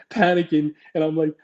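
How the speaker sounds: usual speaking voice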